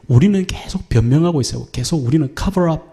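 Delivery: usual speaking voice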